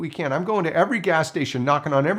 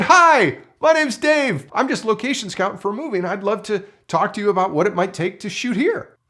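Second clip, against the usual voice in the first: much higher and louder, bright nervous friendliness; forced cheer; 7 semitones higher, 5 dB louder than usual. Retold or imitated. Scout greeting the scary guy, forced cheerful pitch. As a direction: smile through the fear. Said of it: "So I turned around and said".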